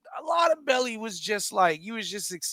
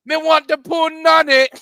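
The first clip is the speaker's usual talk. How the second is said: higher, louder mock reggae sing-song; mock Seagal reggae; 8 semitones higher, 10 dB louder than usual. Mocking Steven Seagal's reggae song to friends, hammed-up sing-song. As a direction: ham up a bad reggae lyric. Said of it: "he got a song like".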